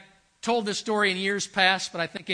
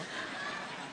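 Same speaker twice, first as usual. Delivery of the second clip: a breathy laugh under the story; amused chuckle mid-anecdote; soft unvoiced chuckle; 11 dB quieter than usual. Real, not acted. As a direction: let a quiet laugh slip out. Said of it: "teller laughing".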